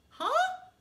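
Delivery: high, loud startled huh. Acted. Fred startled by Sister's shouting, baffled huh. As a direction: jolt up in disbelief.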